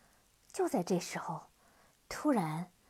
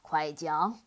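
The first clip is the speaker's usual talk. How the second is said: low, curt demon voice; cold command; 6 semitones lower, 3 dB louder than usual. Acted. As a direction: snap it out coldly. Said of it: "黑蜂王冷冷地说".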